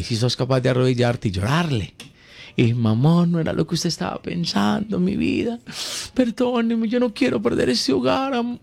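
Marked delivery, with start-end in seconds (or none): 2.7-8.6 high, whimpering plea for forgiveness; hammed-up sobbing supplication; mock tearful begging. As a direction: beg like a caught cheater, voice pitched way up. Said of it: "Uno llora, y uno pide perdón".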